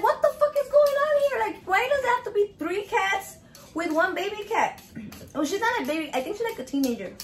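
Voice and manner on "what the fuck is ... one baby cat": high-pitched, indignant retold reaction; outraged disbelief. Cat owner reacting to the gang of cats, outraged disbelief.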